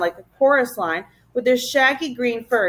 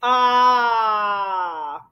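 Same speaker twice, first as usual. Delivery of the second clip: long drawn-out fake-pity moan; mock-sad "aww". A narrator's reading. Gaboons all pretending sadness, mock wail. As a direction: let out a slow exaggerated aah. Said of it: "pretended to be sad".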